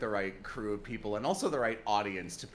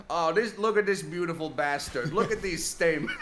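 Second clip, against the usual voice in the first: put-on Chicago accent; 4 semitones higher, 4 dB louder than usual. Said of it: "he'll look at a plant and just go like".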